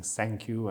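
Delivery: "thank you" said as "sank you"; imitating old accent. Recalling how he used to talk, "thank you" with s for th. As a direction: swap the th of "thank" for an s. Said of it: "that's how I used to talk".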